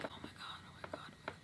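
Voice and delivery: very soft acted panic; hushed, repeated scared whisper; panicky whisper. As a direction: whisper it under your breath in fear. Acting out panic while hiding.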